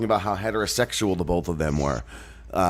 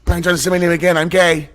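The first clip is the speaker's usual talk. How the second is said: high, loud excited gushing, building up; giddy fanboy; 8 semitones higher, 5 dB louder, faster than usual. Retold or imitated. Quoting himself gushing over [name], rising giddy excitement. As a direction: gush it louder each time. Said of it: "I was like".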